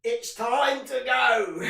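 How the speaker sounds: drawn-out, loud catchphrase impression; Claude from Arsenal Fan TV. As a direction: imitate a fed-up football fan's slow, loud catchphrase. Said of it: "R I P Claude from Arsenal Fan T V".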